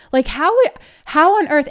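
high, loud disbelief; how on earth, rising in real exasperation; real astonished frustration; exasperated. Real, not acted. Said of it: "exasperated outburst".

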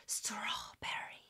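put-on slow breathy whisper of the TikTok girl; imitating her whisper. Vlogger mimicking a TikTok girl's slow whisper. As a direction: whisper each word like the clip. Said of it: "she'll be like".

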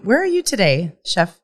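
normal talking voice

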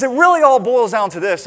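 declaring it like a settled truth; an old man announcing his big conclusion; grand conclusion. Retold or imitated.

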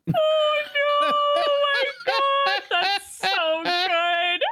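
high delighted squeal; excited squeal. Real, not acted. Delighted squeal at content plan.

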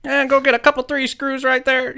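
imitating a high-voiced farmer boss; farmer impression. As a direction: mimic the old farmer's order. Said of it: "And he would say".

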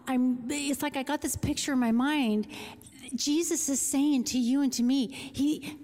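usual speaking voice

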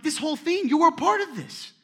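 high, loud exasperated yell; retold yell. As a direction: throw it at him like you can't believe it. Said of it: "we're even yelling at him sometimes".

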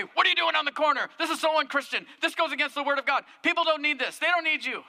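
hammed-up judgmental preacher lecturing; mock preachy scolding. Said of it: "And I didn't say".

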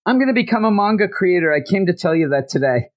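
high, fast declaration without real volume; mock yelling. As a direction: blurt it out like a pretend yell. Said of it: "he's just yelling".

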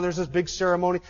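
usual speaking voice

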